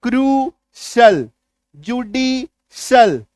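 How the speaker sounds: सोशल, आर्टिफिशियल, बेनिफिशियल each drawn out slowly, ending in "sal"; slow, clear demo of each word's "sal" ending. Shown as a demonstration. Teacher drills students on the "sal" ending, slow and deliberate. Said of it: "notice करो ये vowel".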